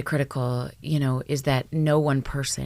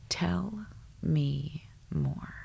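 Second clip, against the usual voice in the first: gentle; 6 dB quieter, much slower than usual. Shown as a demonstration. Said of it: "this is really difficult".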